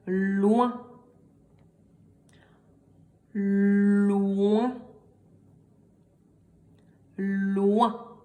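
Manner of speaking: "loin" with a long held L gliding into the vowel; dictated syllable. Shown as a demonstration. Teacher dictating a syllable three times, "loin" drawn out. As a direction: stretch out "loin" slowly, sound by sound. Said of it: "je vais vous les répéter trois fois".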